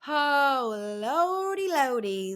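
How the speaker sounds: drawn-out, high swooping lament; playful exasperation. Real, not acted. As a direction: wail it theatrically over the hard work of parenting. Exasperated about raising four girls.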